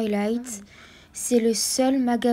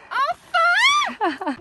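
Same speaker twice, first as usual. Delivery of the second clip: relieved cry; 9 dB louder than usual. Real, not acted. Reaching the summit after long climb.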